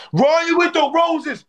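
sudden high celebratory call; excited high-pitched cheer; hype shout-out. Real, not acted. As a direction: burst out high for the gifter.

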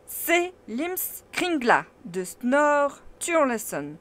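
loud, eager lecturing professor; proud scholar showing off his book; animated uncle. A narrator's reading. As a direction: declaim it with growing excitement. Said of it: "en s'animant".

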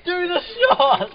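excited high shout. Real, not acted. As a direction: yell urgently to go now. Urging the shot during filming.

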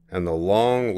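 ordinary delivery

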